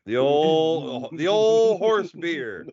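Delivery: loud, high, slow hammed-up voice; goofy slow announcer; silly put-on voice. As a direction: stretch it like a goofy character. Riffing in a silly voice.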